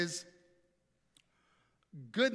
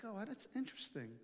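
puzzled aside; 3 semitones higher, 11 dB quieter than usual. Retold or imitated. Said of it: "puzzled young immigrant reaction".

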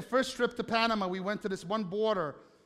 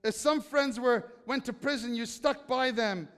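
louder, driving sermon read of Hebrews; preaching a scripture reading; 3 semitones higher, 5 dB louder, slower than usual. Acted. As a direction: preach the passage with conviction, slowing on key lines. Reads Hebrews passage.

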